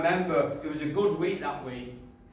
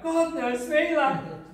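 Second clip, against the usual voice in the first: high, lightly voiced mate's exclamation; a quick high retelling of Keith's line; mock exasperated Keith; 12 semitones higher, 4 dB louder, slower than usual. Keith's exclaim retold to the listener, in a lightly voiced high mock tone. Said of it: "Keith, you went".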